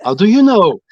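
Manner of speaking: high, lilting mock surprise; people exclaiming. People spotting the speaker's French origin, in exaggerated high-pitched surprise. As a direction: imitate amazed strangers, pitch swooping up. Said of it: "people say".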